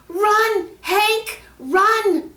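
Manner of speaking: very high, loud urgent shout. Acted. Peter shouting to Hank fleeing elephants, loud and urgent. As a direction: scream it to warn the dog. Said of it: "shouted Peter".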